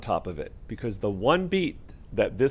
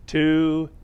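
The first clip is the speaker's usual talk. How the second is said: spoken count; 6 semitones higher, 6 dB louder than usual. Shown as a demonstration. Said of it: "the counts here would be like this".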